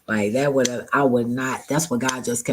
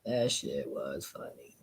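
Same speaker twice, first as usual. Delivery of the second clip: low, soft, slow echo; quiet repeat; 11 semitones lower, 12 dB quieter than usual. Real, not acted. Repeating to himself, quiet and low. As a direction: drop it down to almost nothing. Repeats it much lower and softer.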